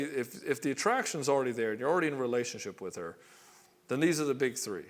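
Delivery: ordinary talk, nothing marked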